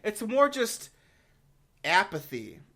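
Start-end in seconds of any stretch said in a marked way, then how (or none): none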